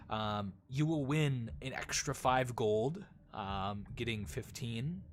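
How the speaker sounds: normal talking voice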